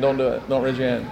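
hushed mock warning to the congregation; joking aside. Real, not acted. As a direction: drop to a quiet playful aside. Pastor jokes with congregation.

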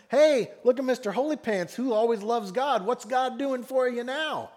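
high, loud jeering at the holy one; mocking taunt. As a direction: taunt him like a scornful bystander. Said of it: "what is their chief taunt".

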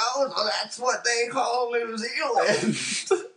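high, slow, drawn-out wonder; imitated grandpa's astonishment, stretched out; amazed grandpa. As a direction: marvel loudly like a grandpa, half laughing.

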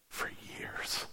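hushed whisper. Acted. The pastor whispering about her years of shame. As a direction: drop to a bare whisper.

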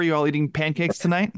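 ordinary delivery

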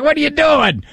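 high-pitched baffled grandfather; lightly mocked-up grandpa disbelief; Papo. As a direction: question thrown high, like a bewildered grandpa. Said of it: "I could only picture Papo".

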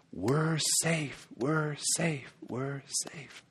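soft, hushed relief; relieved whisper. Retold or imitated. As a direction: breathe it out quietly, relieved. Crowd relief voiced softly.

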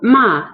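ma falling from a high start; dropping fourth tone. Shown as a demonstration. Teacher giving the word for scold, dropping tone. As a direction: drop ma quickly downward. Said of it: "reducing tone or drop".

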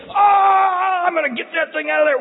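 high shouted glee, fast; sadistic dentist. Pretend. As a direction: yank it out with mock relish.

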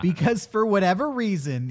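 ordinary talk

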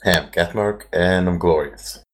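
usual speaking voice

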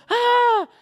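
put-on very high, loud child's shriek; mock scream of a panicked toddler; terrified scream. Three-year-old fleeing his father, acted terrified scream. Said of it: "terrified and running".

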